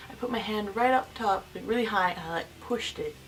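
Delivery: ordinary talk, nothing marked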